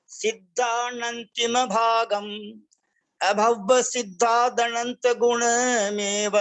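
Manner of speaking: slow, measured chanting of a Prakrit verse; verse recitation. Shown as a demonstration. Teacher reciting the verse slowly for students to repeat. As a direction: recite each line slowly and deliberately, syllable by syllable.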